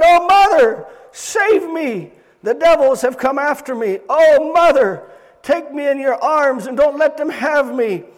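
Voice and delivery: high, loud pleas to mother; terrified crying out. Retold or imitated. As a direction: cry for rescue in terror. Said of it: "And then again he cried".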